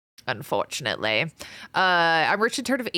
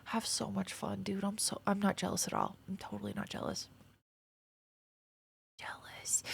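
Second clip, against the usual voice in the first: whispered, mock denial of jealousy; teasing hushed not-jealous; 13 dB quieter than usual. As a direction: murmur it like a guilty secret.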